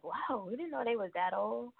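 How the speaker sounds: mock amazement. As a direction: imitate being amazed, teasingly. Mimicking past amazement at people's ages.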